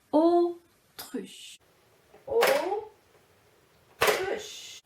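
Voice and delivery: "Autruche" high, in two separate beats; syllable by syllable. Shown as a demonstration. Teacher before asking syllable count, syllable by syllable. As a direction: pause between the syllables of "Autruche". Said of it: "Combien de syllabes".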